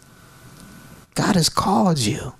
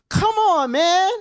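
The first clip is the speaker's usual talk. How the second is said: exasperated whine; much slower than usual. Retold or imitated. Retold complaint to God.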